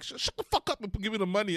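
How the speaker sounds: imitating the robber barking at the teller; mock robber threat. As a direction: rattle off a mock stick-up demand. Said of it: "And he's like".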